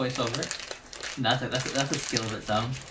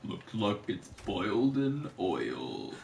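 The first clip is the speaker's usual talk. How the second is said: low, slow cartoon character voice; Avatar character voice; 5 semitones lower, 7 dB quieter, slower than usual. Imitating a guy from Avatar, low slow voice. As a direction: drop low and draw it out. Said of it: "I think of the Avatar voice".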